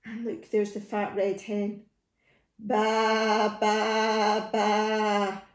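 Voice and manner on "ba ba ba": long, steady sheep bleats on one note; hen baaing. Acted. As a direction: bleat three drawn-out baas like a sheep. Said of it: "said the fat red hen".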